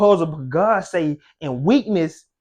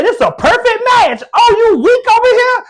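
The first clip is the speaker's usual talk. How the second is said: high, loud shouted voice of God; God's shout; 9 dB louder than usual. Retold or imitated. Preacher shouting God's reply to the weak, loud and high. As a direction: shout God's answer with joy. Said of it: "he said".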